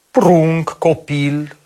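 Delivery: ordinary delivery, nothing marked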